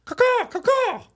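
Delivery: high, loud two-beat caw; imitated bird caw. Boys imitating birds in the dressing room, piercing caws. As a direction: squawk like a crow, mocking teammates. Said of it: "all these, like, sounds".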